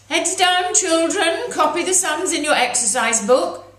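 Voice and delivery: high, loud schoolmistress voice calling the class to order; stern teacher. Acted. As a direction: bark orders at the class like a strict old teacher.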